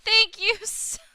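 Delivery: high, loud, choked-up thanks; overwhelmed and teary. Real, not acted. Streamer thanking her chat, giddy and near tears. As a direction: gush your thanks, close to tears. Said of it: "I'm gonna cry".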